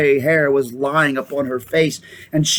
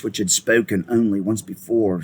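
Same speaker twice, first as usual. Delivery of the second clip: plain reading voice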